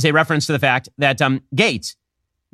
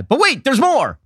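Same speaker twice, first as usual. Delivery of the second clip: infomercial; 9 semitones higher than usual. Retold or imitated. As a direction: sell it like a TV pitchman. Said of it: "I'm now the infomercial guy".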